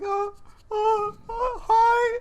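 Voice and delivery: high, strained nervous squeal of a greeting; nerve-racked. Retold or imitated. Nervous student freezing on the morning announcements, nerve-racked. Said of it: "Adam is instantly nerve ra-, racked".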